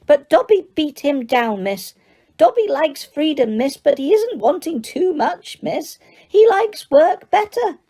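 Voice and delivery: high, squeaky elf voice, eager to please; squeaky house elf. Acted. House elf tells Harry he needs work, high and squeaky. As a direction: pitch it up as an excitable little elf. Dobby continues.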